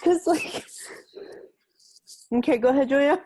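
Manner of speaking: high, loud, choked-up voice; close to tears. Real, not acted. Tearful friend handing the podcast back to co-host. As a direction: push words out through rising tears. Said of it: "I'm gonna cry".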